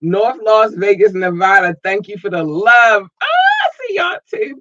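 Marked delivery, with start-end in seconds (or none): 3.2-4.6 much higher delighted shout; thrilled falsetto call-out; excited squeal. Real, not acted. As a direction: squeal it when you spot them.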